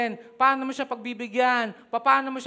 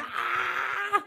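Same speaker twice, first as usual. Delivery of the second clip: crowd scream imitation; 9 semitones higher than usual. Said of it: "grabe yung sigawan".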